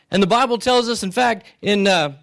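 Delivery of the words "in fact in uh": ordinary delivery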